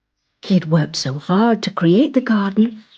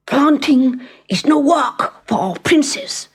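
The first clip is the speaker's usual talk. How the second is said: grumbling prince; 6 semitones higher than usual. Acted. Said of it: "he mumbled".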